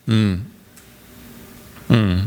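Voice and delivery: low, quiet thoughtful hum from Jesus; a doubtful low hum, barely voiced; quiet hmm. Jesus humming low and quiet at Peter's boast. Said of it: "you can just see him quietly go".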